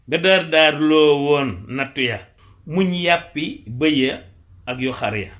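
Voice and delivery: slow, lifted declaiming of verse; poem recitation. Acted. Teacher reciting a Wolof praise poem, declaimed. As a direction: stretch the verse out, strong at the start.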